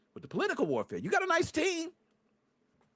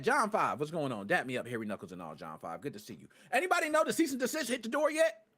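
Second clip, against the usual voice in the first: ordinary talk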